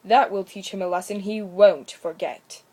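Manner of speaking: deliberate punishing resolve; stern woodcutter. A narrator's reading. Grim woodcutter speaks.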